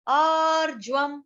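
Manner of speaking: आर्जवम् with full ब and a half म at the end; pron आर्जवम्. Shown as a demonstration. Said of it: "लेकिन यहाँ पे आर्जवम्". (आर्जवम् में ब पूरा और म हलंत)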